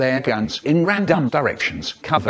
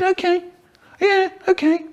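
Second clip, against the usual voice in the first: very high frightened yes; scared squeak. A narrator's reading. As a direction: squeak out a panicked agreement. Frightened hostage.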